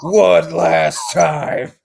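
slow, dramatic announcer voice; monster truck ad. Retold or imitated. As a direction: build the hype like a monster truck ad. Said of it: "Monster Truck, uh, commercials".

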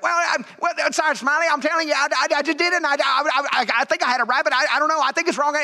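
high, stammering, flustered Southern voice; excited Southern trainee. Retold or imitated. Trainee explaining a missed valve to his sergeant, excited stammering. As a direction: trip over your words, worked up and Southern. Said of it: "he got, like, really excited".

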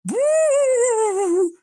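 long, high spooky "woo"; ghostly wail. Pretend. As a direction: howl like a cartoon ghost. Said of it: "an actual live ghost".

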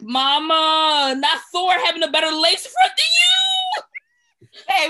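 high, loud wailing shout; mock outrage. Roasting the actress's wig, loud and high. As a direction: cry it out in mock despair. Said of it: "And I was like".

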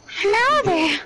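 high, creepy hello there. Acted. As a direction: put on a creepy stranger voice. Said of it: "I can do a pe-, pedophile voice".

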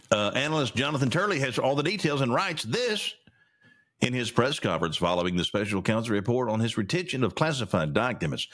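ordinary talk

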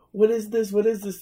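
high, loud mock panic; freaking out. Woman to her boyfriend about the burning cream, retold panic. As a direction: imitate a panicked woman demanding answers. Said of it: "she's freaking out".

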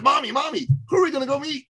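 put-on high-pitched, eager child voice; excited little boy. Little son asking Mommy who they'll meet, imitated excited high voice. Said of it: "in that excited way little boys do".

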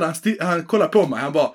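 usual speaking voice

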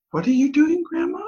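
lightly voiced curious kids; child's voice. Grandkids asking grandma what she's doing, high curious voice. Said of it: "and they said".

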